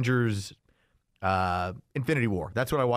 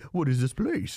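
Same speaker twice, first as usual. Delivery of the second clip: puzzled puppy look. Retold or imitated. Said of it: "you're looking at me like a puppy dog".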